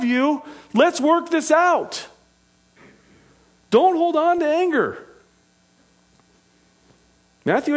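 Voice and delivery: high-pitched, impassioned sermon delivery; forceful preaching. Real, not acted. Preacher urging the church to seek repentance, forceful preaching.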